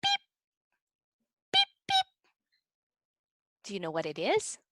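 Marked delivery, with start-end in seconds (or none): 0.0-2.1 tiny, very high short peeps; duckling peeps. Pretend. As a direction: peep like a little duckling. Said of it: "it likes to say".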